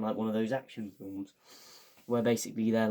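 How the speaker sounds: ordinary talk, nothing marked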